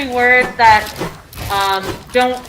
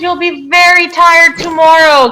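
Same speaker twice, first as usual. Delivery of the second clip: mother's voice; 7 semitones higher, 4 dB louder than usual. Acted. Teacher voices mother in example sentence.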